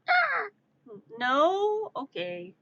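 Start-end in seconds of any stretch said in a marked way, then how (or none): 0.1-0.5 high, short imitated squawk; mimicked bird cry; crow squawk. Imitates the crow's answer.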